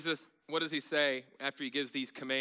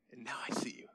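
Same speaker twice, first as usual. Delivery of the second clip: awed whispered aside; 3 dB quieter, faster than usual. Pretend. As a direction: whisper it as if face to face. Imagined meeting with Jesus.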